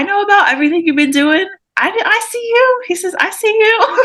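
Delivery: bright high excited rush. Acted. Brother telling his sister he sees her, excited and high. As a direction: burst out with joy.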